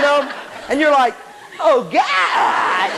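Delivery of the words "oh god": drawn-out horrified cry; mock horror. Student seeing slept-on hair in the mirror, horrified. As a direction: cry out in comic despair.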